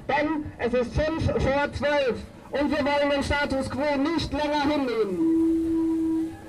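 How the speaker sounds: ordinary talk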